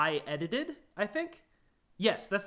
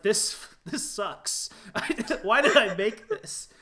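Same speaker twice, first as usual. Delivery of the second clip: slow start, breaking into louder, amused disbelief; laughing mock regret; 5 semitones higher, 6 dB louder, slower than usual. Fan fiction author disowning his own story, laughing mock regret.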